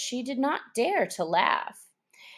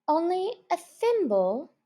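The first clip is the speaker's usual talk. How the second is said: sad; 10 semitones higher than usual. A narrator's reading. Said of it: "said Alice sadly".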